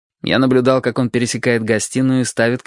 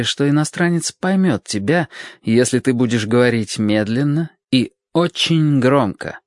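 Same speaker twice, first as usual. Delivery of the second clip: plain reading voice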